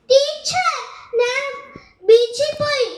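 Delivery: high, loud eager answer; a child bursting to tell teacher. Acted. (ആവേശത്തോടെ ഉറക്കെ)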